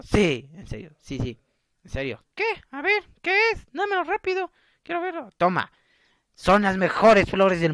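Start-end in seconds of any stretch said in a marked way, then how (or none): 2.4-5.3 put-on high falsetto girl's voice; cartoonish falsetto, pressing for an answer; impatient girl friend. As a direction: put on a squeaky, demanding girl voice. Girl demands the gift.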